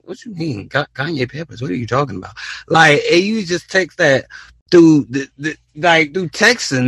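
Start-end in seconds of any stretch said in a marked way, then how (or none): 0.0-2.3 confused squeak. Retold or imitated. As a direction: squeak out the confusion, then sink quiet. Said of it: "Have you ever heard somebody just say".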